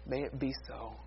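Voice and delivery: soft, low prayerful close; hushed amen. Real, not acted. Preacher closing the sermon in prayer, hushed and low. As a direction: drop low and soft to seal it.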